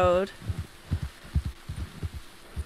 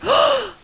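shocked gasp; 13 semitones higher, 3 dB louder than usual. Real, not acted. Horse found dead.

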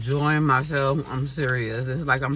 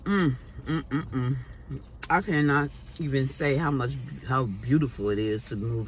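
savoring with closed lips; chewing while talking. Real, not acted. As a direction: mouth partly full. Seen 1: Lips pressed and working while humming; dip container held nearby.

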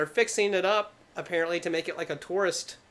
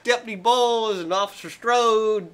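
louder, higher imitation of a small-town janitor; 5 semitones higher, 7 dB louder than usual. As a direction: podcaster imitating the janitor from the movie. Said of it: "he says some redneck shit".

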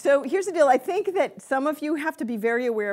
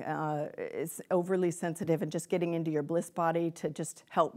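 ordinary delivery

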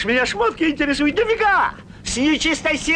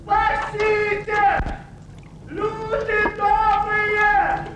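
протяжный крик о помощи, медленно; тянет слова, зовёт людей издалека; зов на помощь; 5 semitones higher, much slower than usual. Acted. Человек тонет и зовёт на помощь. (медленный крик о помощи)

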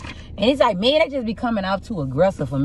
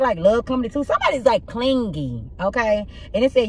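ordinary talk, nothing marked

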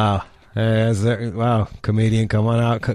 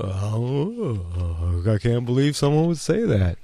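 lightly voiced scandalized old-timey host; a hint of clutching pearls at a dirty answer; mock shocked "oh, oh" then prim disbelief; prudish Jack Benny gasp; slower than usual. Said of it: "fucking Jack Benny look".

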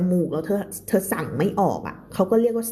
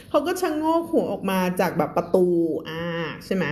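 ordinary delivery

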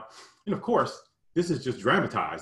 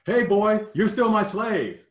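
playground taunt; 10 semitones higher, 6 dB louder, slower than usual. Retold or imitated.

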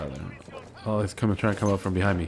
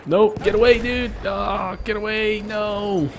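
very high, loud panicked pleading; 13 semitones higher, 7 dB louder, slower than usual. Real, not acted. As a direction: beg not to die, panicking. Being shot, near death.

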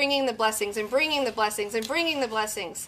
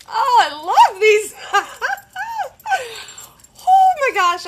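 high, loud laughing squeal; giddy squeal; 10 semitones higher, 6 dB louder than usual. Real, not acted. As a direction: gush with giddy delight. Gushing, laughing over earrings.

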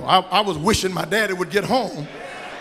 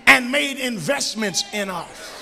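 loud, higher, slow preacher swell; preaching at full force; 5 semitones higher, 4 dB louder than usual. Acted. Pastor honouring his mother, preaching loud. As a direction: stretch out she stayed home.

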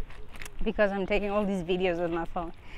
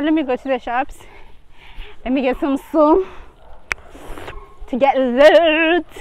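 excited; 4 semitones higher, 7 dB louder than usual. Retold or imitated. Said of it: "I thought".

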